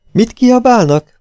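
high, loud, drawn-out query; puzzled question. A narrator's reading. Coachman asks about the shouting outside, puzzled and high.

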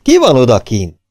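loud, forceful question; shouted challenge. A narrator's reading. Policeman calls to whoever is outside, loud challenge. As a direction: call out through a closed door. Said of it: "kiáltott a rendőr".

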